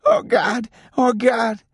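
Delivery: acted high-pitched scream of agony. A narrator's reading. Shot boy lying on the ground in his overalls, screaming. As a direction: cry out high and strained, as a wounded boy. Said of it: "he was screaming".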